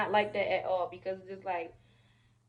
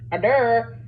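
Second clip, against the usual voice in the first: louder, chanted or der, or der; mock call for order; 9 dB louder, much slower than usual. Friends hushing the scene with a loud mock order.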